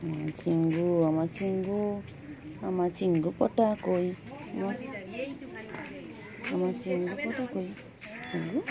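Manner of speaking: a drawn-out tender call for a pet. Real, not acted. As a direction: coo slowly and softly to the cat. Cooing baby talk to cat. (বিড়ালকে আদুরে গলায় ধীরে ডাকা)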